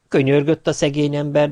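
usual speaking voice

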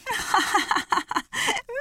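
high, put-on hare laugh. A narrator's reading. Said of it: "kacagott a nyúl".

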